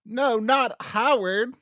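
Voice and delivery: high, loud, drawn-out mock shock; mock dismay. Co-host reacting to a cliffhanger, mock dismay. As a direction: draw it out in exaggerated dismay.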